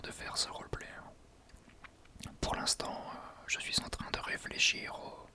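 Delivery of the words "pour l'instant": ordinary talk, nothing marked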